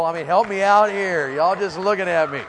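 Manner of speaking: loud, high mock plea to the crowd; exasperated appeal. Real, not acted. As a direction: call out for some backup, loudly. Pleading with an unresponsive audience.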